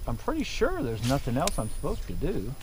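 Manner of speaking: ordinary delivery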